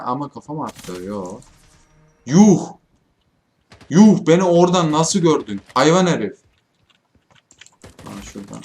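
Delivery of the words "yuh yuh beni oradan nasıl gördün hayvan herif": loud, high disbelieving "yuh"; outraged, loud and higher; indignant surprise. Real, not acted. Gamer shot in the head from nowhere, loud indignant disbelief.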